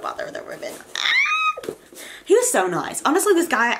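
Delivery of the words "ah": a sudden high delighted squeal; excited squeal. Real, not acted. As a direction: squeal with joy as you start.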